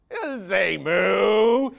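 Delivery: put-on kids correcting. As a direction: drag it out like indignant kids. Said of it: "they can hear me go".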